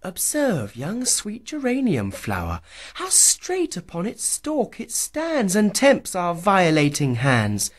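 put-on high, bright young woman's voice, lifted well above the narrator; Susan's voice. A narrator's reading. Susan pointing out the flower to her lover, high and eager. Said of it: "said Susan in a lucky hour".

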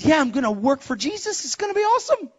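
high, gleeful retold enthusiasm; really excited. New believer telling his wife about the job, excited. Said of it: "You are really excited".

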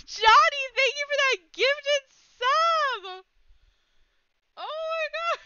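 very high, squealing thanks; shriek, then keep gushing high in disbelief; real giddy near-tears squeal, easing gradually; overwhelmed delight. Real, not acted. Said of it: "I'm gonna cry".

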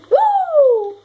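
high, loud whoop; cheering. Real, not acted. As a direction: whoop with joy.

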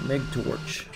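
ordinary talk, nothing marked

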